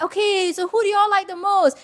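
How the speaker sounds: high, bright host call to the crowd; nervous host voice. Retold or imitated. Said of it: "And I'm like this, I'm like".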